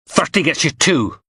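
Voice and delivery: Sparks asserting himself. A narrator's reading. Sparks repeats price asserting authority.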